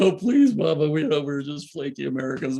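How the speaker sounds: high, loud begging that settles down; pleading. Retold or imitated. Flat on their faces before Baba, begging forgiveness in a high voice. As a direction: beg at his feet, half laughing.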